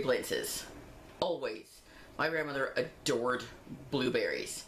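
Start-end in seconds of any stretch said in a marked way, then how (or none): none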